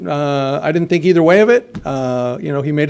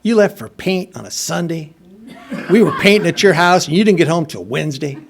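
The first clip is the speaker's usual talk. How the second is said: Alabama woman; 3 semitones higher than usual. Retold or imitated. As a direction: play a mother-in-law from Alabama. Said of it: "she was an Alabama woman".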